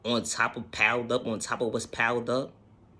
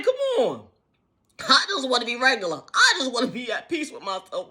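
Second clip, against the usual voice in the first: high, loud, hammed-up whine; mock whining; 8 dB louder than usual. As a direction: plead like a dramatic crybaby. Acting out being overwhelmed.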